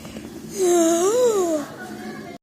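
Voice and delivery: high, breathy crying gasp. Acted. Character gets hiccups.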